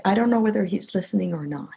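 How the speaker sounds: ordinary delivery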